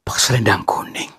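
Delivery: whisper. Real, not acted. Describing unseen figure quietly.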